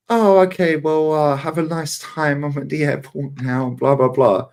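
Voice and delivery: high, slow, sulky put-on voice; hammed-up fake-polite brush-off; imitating his girlfriend pretending she's fine, high and slow; mock huffy girlfriend.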